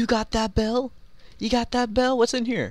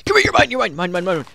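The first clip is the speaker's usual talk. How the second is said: battle shout; 3 semitones higher, 6 dB louder than usual. Real, not acted. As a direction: roar at the enemy you're chasing. Charging an enemy in game.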